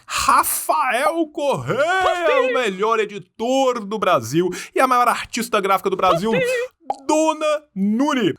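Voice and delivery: high, slowed, stretched out hype intro; hype announcer. Acted. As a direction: announce them like a show host. Hyped intro of team members.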